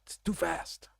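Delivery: whispered aside, under his breath. Real, not acted. Streamer whispering an aside over the playing clip. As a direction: murmur it so the clip isn't drowned out.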